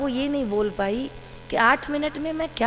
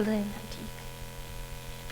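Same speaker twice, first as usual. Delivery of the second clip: resigned whisper; 14 dB quieter, much slower than usual. Retold or imitated. Silent acceptance of guru's order.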